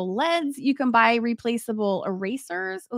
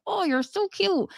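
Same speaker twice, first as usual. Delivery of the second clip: cooing at a cat; 8 semitones higher than usual. Retold or imitated.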